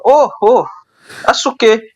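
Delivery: high Irish lady voice; Irish accent. Retold or imitated. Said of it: "busted out a good Irish accent".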